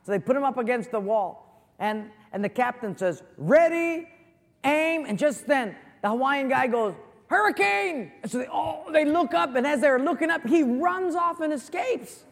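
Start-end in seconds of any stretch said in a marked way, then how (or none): 3.4-5.1 high, loud "Ready, aim" called out; captain's command shout. Acted. Joke captain commanding the firing squad, loud shout. 7.3-8.1 a high, loud yelled warning; high shout of alarm. Acted. Hawaiian prisoner faking alarm to distract guards, high shout.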